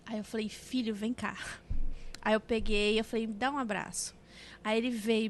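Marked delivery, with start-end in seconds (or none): 0.5-1.4 put-on soft, gentle call to a child; mãe acolhedora. Retold mother calling child gently. 3.3-4.1 soft, tender request; mãe carinhosa. Retold or imitated. Retold mother asking for hug.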